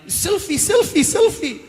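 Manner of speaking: high, squeaky nervous fan begging. Retold or imitated. Nervous fan asking the actor for a selfie, high and squeaky. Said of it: "the ones that get really nervous".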